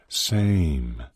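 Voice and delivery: "Same" lilted in a sing-song. A narrator's reading. Sean chimes in on "Same", sing-songing it. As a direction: draw out "Same" with a teasing lilt. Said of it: "Sean sing-songed".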